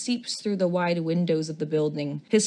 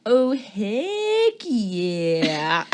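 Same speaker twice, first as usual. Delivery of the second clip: drawn-out excited; 3 semitones higher, 4 dB louder, much slower than usual. Acted. Enthusiastic reply, drawn out.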